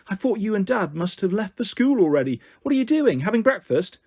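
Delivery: brisk rising motherly questions; animated mum on the phone. A narrator's reading. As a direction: quiz your child eagerly down the line.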